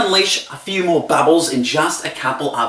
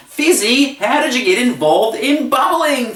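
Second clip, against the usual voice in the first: high, sing-out prompt; crowd question; 7 semitones higher than usual. Retold or imitated. Host cueing the crowd to shout in unison, high-pitched. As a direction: model a group chant, pitched up. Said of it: "were to shout out in unison".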